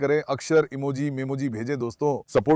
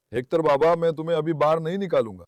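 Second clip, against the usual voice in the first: usual speaking voice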